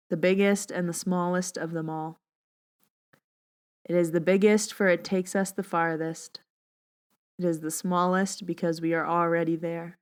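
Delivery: soft, calm meditation voice; unhurried, soothing reading voice; guided meditation. Acted. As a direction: guide listeners gently into their breath.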